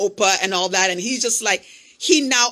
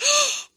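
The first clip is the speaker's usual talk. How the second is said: excited gasp. Retold or imitated. Friend recognizes him.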